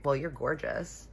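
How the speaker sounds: normal talking voice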